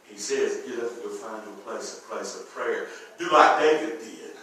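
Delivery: very low, soft sermon voice; hushed preaching. Retold or imitated. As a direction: drop to a grave, intimate hush.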